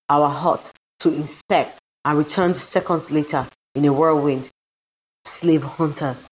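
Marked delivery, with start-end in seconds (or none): none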